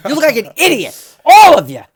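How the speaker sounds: high, loud yell of disgust; shouting in outrage; angry shout. Real, not acted. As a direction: scold them all at full volume. Yelling at bunny-ear wearers.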